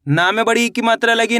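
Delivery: normal talking voice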